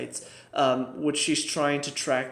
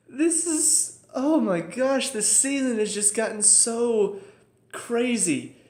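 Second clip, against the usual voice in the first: overwhelmed; 8 semitones higher, slower than usual. Real, not acted. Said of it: "reacting to season twist".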